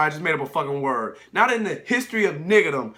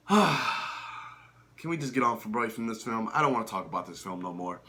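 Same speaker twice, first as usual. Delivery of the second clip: weary plea; 4 semitones lower, 4 dB quieter than usual. Real, not acted. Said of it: "tired of talking about film".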